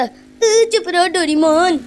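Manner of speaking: high, drawn-out mock-angry yell; exasperated scolding. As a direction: stretch it out, fed up with a friend. Acted quarrel over the eaten burger. (खीझ भरी लंबी बनावटी डाँट)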